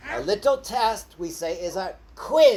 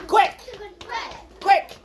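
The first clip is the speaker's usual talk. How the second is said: excited call; 10 semitones higher than usual. Shown as a demonstration. Teacher calls out quick.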